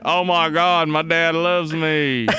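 put-on student voice, drawn out at the end; mock-moved son, stretching the last words; Nate. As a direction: gush as a student impersonation. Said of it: "And Nate's like".

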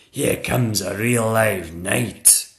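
slow teacher's announcement; dragon schoolmistress. Acted. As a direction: announce the newcomer to the class.

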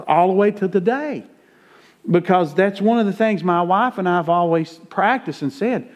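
preaching at full force. Acted. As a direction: preach it out to the back pew. Preaching builds to climax.

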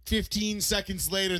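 normal talking voice

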